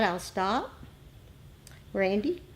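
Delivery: normal talking voice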